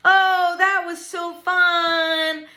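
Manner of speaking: high, loud, drawn-out gushing; sing-song storytime delight. Real, not acted. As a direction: stretch it out with glee. Delighted wrap-up for children.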